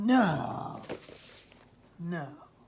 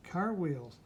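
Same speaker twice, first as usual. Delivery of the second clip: usual speaking voice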